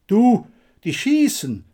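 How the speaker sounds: loud, high shouted warning; alarmed bellow. A narrator's reading. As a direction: yell it out under fire. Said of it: "brüllte der Blasse".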